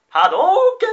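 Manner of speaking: loud, high Hadouken shout; Ryu impression. Shown as a demonstration. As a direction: yell the special move like Ryu. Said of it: "細かすぎて伝わらないモノマネ".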